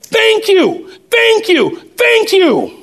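high, overjoyed cries of thank you; grateful cashier crying out thanks; yelled thanks. Retold or imitated. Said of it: "she yelled".

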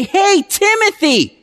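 cheerful hail to a returning visitor; friendly greeting. Retold or imitated. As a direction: greet him like you're glad to see him. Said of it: "you can say".

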